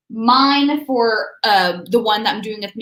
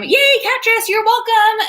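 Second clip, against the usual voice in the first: yay cheer; 10 semitones higher, 4 dB louder than usual. Real, not acted. Cheering a viewer by name.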